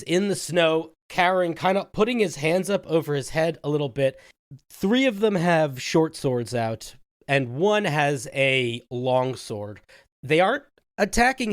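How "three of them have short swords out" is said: ordinary talk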